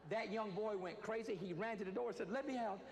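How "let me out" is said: high, loud panicked cry to be let out; panicked plea. Retold or imitated. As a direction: beg to escape, frantic and loud. Terrified boy runs to door.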